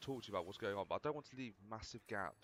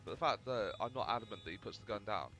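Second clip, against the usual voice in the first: normal talking voice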